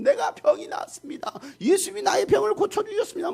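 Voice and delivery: very high, excited healed man spreading news; acted excited proclamation. Healed man spreading word all over town, excited high cry. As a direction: play an overjoyed man telling the whole village. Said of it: "막 동네방네 다니면 막 소문을 내기 시작하는".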